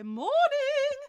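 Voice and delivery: high, loud sing-song posh hello; exaggerated posh chirpy morning; posh cheery greeting. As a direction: trill it like a posh landlady at the door. Posh landlords arriving cheerily.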